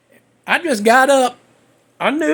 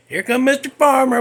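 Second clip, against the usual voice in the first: normal talking voice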